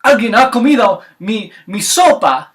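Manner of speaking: high, loud put-on Spanish bellow; loud papa bear. Papa bear finding his soup eaten, loud. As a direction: roar it in mock Spanish outrage. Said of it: "the papa bear's like".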